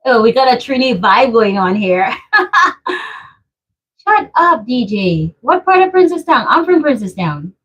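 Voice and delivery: ordinary talk, nothing marked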